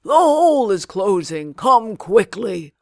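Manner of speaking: put-on loud urgent old man's shout; old man shouting to Aladdin inside the cave. A narrator's reading. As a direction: yell it in a panic from outside the cave. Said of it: "he heard the old man begin to shout".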